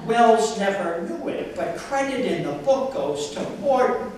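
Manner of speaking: deadpan comic poetry recital with deliberate phrasing; poem reading. Acted. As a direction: read it out line by line to an audience, punching key words.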